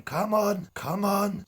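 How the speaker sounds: high, loud mock challenge; exaggerated copy of someone egging a rival on; mocking goading. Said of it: "you're sitting there going".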